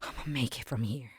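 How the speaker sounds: whispered self-talk. Retold or imitated. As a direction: whisper a promise to yourself. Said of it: "I was like".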